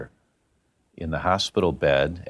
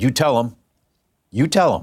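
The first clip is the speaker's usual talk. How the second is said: higher, firm charge from a retold godmother; fiery insistence; 4 semitones higher, 3 dB louder than usual. Dying godmother charging Tommy to tell, fiery. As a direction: press it on him with fire. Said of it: "she gets her fire in her eye".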